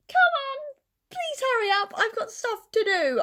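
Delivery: high, pleading "come on, please hurry up"; impatient whine. Real, not acted. Waiting on a late visitor, high mock whine. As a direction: whine it up high, ending in a laugh.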